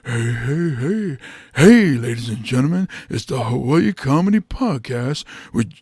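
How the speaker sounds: put-on cartoon hey-hey-hey voice; host the show as a big cartoon character; Fat Albert. Comic wishing for another voice, cartoon impression. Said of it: "even if I sound like Fat Al".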